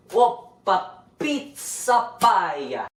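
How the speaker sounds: slow, hammed-up Italian chef flourish; jolly italian pizza chef. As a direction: stretch it out like a showman chef.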